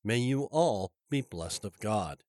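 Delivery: normal talking voice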